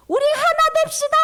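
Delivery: 높고 크게 빠르게 외치기; 모두를 불러 모으듯 외쳐; calling out. Shown as a demonstration. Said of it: "이러는 거야". (높고 크게 빠른 외침)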